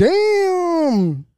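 high, loud drawn-out damn; impressed damn. Real, not acted. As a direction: let it out like a wail. Reacts to girl's photo.

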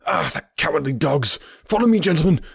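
high, indignant rallying call; rally them to follow; urgent cry. A narrator's reading. Stranger cursing the villains and leading on, high urgent cry. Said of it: "cried the stranger".

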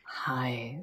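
soft, sweet-talking hi; nice voice. Shown as a demonstration. Hosts trying greeting voices, very nice and soft. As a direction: put on a very nice voice, softly. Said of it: "some very nice voices".

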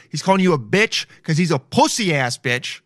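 usual speaking voice

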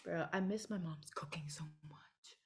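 soft unvoiced longing whisper; hushed ache for mom's cooking. Real, not acted. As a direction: whisper it, missing home.

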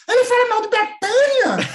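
very high, loud mock-scandalised cry; shocked Caetano. Caetano reacting to the book's insult, shrill mock outrage. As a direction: shriek it as if scandalised.

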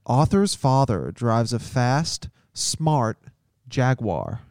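slow American accent drill, hard rhotic r. Shown as a demonstration. Coaching a mate through the American accent sentence. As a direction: model the American accent slowly for a mate to copy. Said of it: "you can copy me, um, in my pronunciation".

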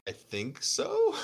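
very high, drawn-out doubtful reply; mock uncertain squeak. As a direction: squeak it slowly, close to laughing. Retold sheepish answer after the rant.

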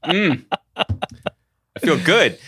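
satisfied mm after a sip; slow, pleased after a drink; while sipping a drink. Real, not acted. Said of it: "let me take a sip of my polyclonal cocktail".

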